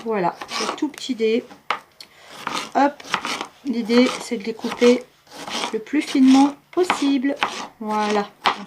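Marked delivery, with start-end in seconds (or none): none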